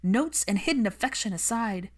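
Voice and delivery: hurt but pressing on; impassioned tearful rant; tearful anger. Acted. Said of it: "Unexpected tears trailed down her cheeks".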